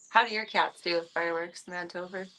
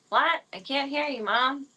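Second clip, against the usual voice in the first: loud bratty "What?"; pretending not to hear; 6 semitones higher, 4 dB louder than usual. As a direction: play the kid who ignores mom. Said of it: "It's typical child".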